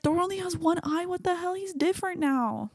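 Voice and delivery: high-pitched mock panic; retold freak-out. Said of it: "I freaked the fuck out".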